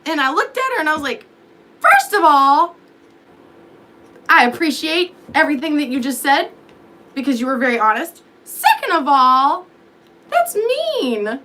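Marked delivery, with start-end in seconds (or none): 1.8-11.4 high, loud, offended protest; indignant. Retold or imitated. Telling the cop off, high and loud. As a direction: make it sound wounded and outraged.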